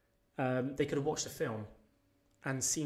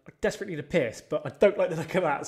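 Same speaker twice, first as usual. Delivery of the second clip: louder and higher, spooked; loud alarmed aside; 3 semitones higher, 9 dB louder, faster than usual. Retold or imitated. Seeing a ghost on the toilet, loud spooked aside. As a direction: blurt it out at the ghost. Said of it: "I'm like".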